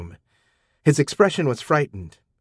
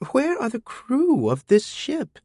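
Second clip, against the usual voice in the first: put-on high, slow girlish voice asking; Amina's voice, pitched up high and young; teen girl puzzled, repeating the question; 14 semitones higher, slower than usual. A narrator's reading.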